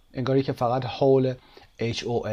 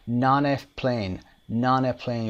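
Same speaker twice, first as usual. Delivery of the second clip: non a plane joined as one word, slow; linked words; much slower than usual. Shown as a demonstration. Teacher demonstrating linking for students. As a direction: run non a plane together.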